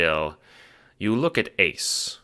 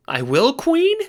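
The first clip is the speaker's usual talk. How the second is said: much higher, louder excited squeal; 6 dB louder than usual. Acted. Henchman squealing excitedly at his important job. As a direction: squeal with delight. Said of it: "he squeals".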